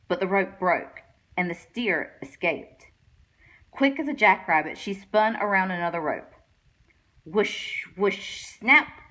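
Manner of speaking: ordinary delivery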